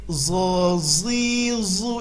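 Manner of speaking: zo zi zu with the tongue kept in; zo, zi, zu said as a heavy z, the mistake; wrong zo. Shown as a demonstration. Teacher shows the wrong sound for huruf dho. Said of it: "Salah, ya".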